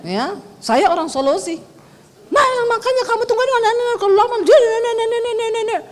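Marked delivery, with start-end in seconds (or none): 2.3-5.8 high, fast mock nagging, nah nah nah. Preacher mocking her silent husband in the car: high, fast nagging.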